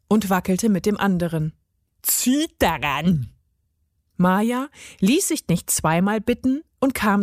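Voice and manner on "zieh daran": lower, slow gnome voice; commanding gnome. A narrator's reading. Gnome tells the girl to pull, commanding. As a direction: give a drawn-out order as the gnome.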